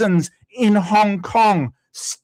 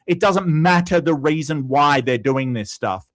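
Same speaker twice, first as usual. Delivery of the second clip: ordinary delivery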